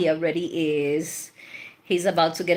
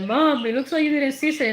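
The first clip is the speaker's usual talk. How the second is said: quick, higher, lightly voiced grown son teasing; imitating her son; 6 semitones higher, faster than usual. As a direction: rattle it off like a teasing son, pitched up. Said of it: "he was like".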